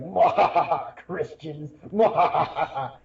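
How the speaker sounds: mock evil laugh, cackling the words; hammed-up villain cackle; mad scientist. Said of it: "he's the evil atheist lawyer who's like".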